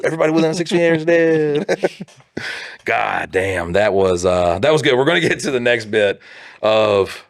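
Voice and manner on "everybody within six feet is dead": high, silly kill announcement; hammed-up playground war voice, pitched up; pretend soldier. Man repeating battle gag as callback, high silly voice.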